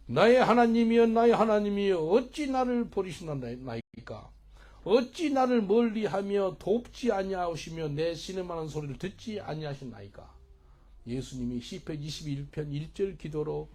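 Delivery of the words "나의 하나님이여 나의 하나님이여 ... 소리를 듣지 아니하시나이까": impassioned psalm reading. Acted. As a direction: read scripture as a cry to God. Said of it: "한번 읽어드릴게요". (시편을 크게 부르짖듯 읽는 낭독)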